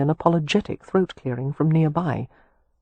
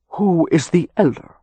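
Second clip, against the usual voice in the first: put-on old earl's louder Anglo-Irish lilt, graceful and lightly arid; lightly flowing Anglo-Irish; 3 dB louder than usual. A narrator's reading. Old Irish earl addressing two young men, flowing Anglo-Irish accent. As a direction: state it with a light, dry Anglo-Irish flow. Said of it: "in flowing Anglo-Irish accent".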